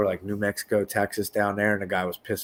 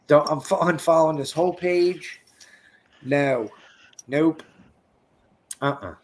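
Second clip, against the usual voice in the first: disgusted; 6 semitones higher, 5 dB louder than usual. Real, not acted. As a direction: draw it out in disgust. Disgusted reaction.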